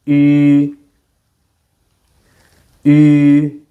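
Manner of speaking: "E" said as E with lips rounded into ü; "E" rounded like saying ooh; Mandarin ü vowel. Shown as a demonstration. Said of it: "So the sound is".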